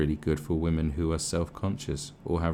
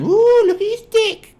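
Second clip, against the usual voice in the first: put-on shrill, much higher, loud squeal of a drunk girl; mock drunk woman; 15 dB louder than usual. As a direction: squeal it like a drunk hen party. Mimics drunk women grabbing men.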